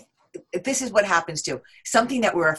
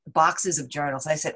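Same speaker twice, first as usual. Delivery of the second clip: ordinary delivery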